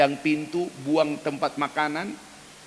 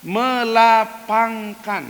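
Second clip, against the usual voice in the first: drawn-out high emphasis; 9 semitones higher, 7 dB louder, much slower than usual. Real, not acted. Preacher stresses the key word.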